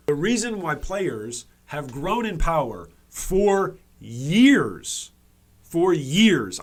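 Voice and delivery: "years" punched loud and drawn out; slow build, hammering "for years"; heavy emphasis. Real, not acted. Streamer driving his point home to viewers, heavy emphasis. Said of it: "I need to really emphasize this".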